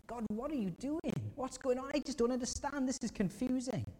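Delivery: high, pleading cry of a grieving widow; a high, anguished voice; anguished plea. Acted. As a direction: cry out to God, bewildered and pained.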